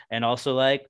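ordinary talk, nothing marked

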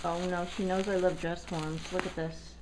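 normal talking voice

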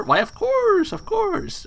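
very high put-on innkeeper voice; high character voice. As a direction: squeak it out as the odd host. Voicing host character.

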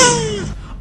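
high gasp of disbelief; sharp gasp. Real, not acted. Player reacting to a card pack, high shocked gasp. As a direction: gasp at the pull.

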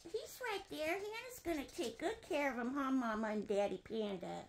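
very soft, hushed comfort; gentle reassurance. Pretend. Reassures toy panda parents.

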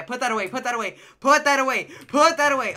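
high, urgent shout building up; panicked pleading. Real, not acted. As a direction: repeat it in mounting alarm. Alarmed at knife pulled in game.

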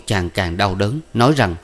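ordinary delivery

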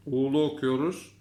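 ordinary talk, nothing marked

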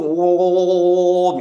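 slow, drawn-out loud moans; eerie chanting moan from the bushes; men's moaning voices. Retold or imitated. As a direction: moan long and loud like unseen men. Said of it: "みたいな".

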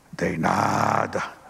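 slow, grudging demon voice; lightly voiced demon giving in; demon confessing. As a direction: drag it out like a beaten spirit.

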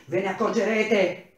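angry engineer yelling at the strikers; yelled. Acted. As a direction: shout the warning. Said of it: "urlò".

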